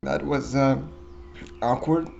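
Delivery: usual speaking voice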